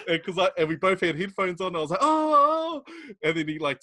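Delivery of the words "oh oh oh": high, breathless startled ohs; excited gasps. Retold or imitated. Reacting to the trailer on headphones, high gasping ohs. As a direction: gasp out high in shock. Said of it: "we were cracking up".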